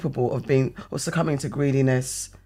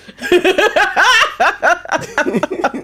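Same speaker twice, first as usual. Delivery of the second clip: a long, loud burst of real laughter; 7 dB louder than usual. Real, not acted. Joking to her friend about hunting Infinity Stones, laughing loudly. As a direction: let the laugh break out high and loud. Laughing at own Thanos joke.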